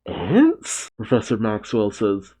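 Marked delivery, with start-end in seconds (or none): 0.1-0.9 high, frightened question; terrified student. Acted. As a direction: act a startled, high-pitched terror. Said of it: "looks up in terror".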